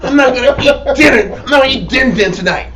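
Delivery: mock baby-talk sulk, half laughing; pouting child. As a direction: play the king as a toddler refusing food. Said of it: "He's pouting his ass off".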